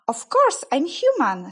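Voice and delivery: indignant surprise. A narrator's reading. Lucy protests again.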